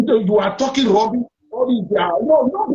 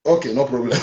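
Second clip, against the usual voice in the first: chuckling through the words; laughing; 9 semitones lower than usual. Real, not acted. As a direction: say it with an amused laugh. Host laughs at departing caller.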